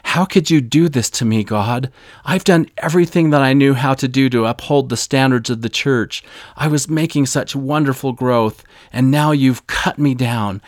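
hurt, loud accusation aimed upward; anguished protest to God. Retold or imitated. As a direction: shake your fist and demand an answer from God. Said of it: "I clenched my fist and I shook it at heaven".

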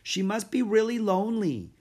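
put-on high eager girl's voice; bright young Annie; little girl voice. Annie's voice in story.

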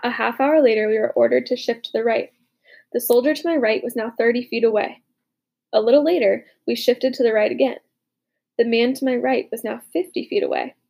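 plain reading voice

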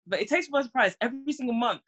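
normal talking voice

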